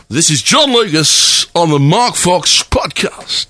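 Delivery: slow, drawn-out host delivery; Mark Fox style demo. Shown as a demonstration. Host shows his guest the slow podcast delivery. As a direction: model the line slowly for him. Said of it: "in the way that Mark Fox would do".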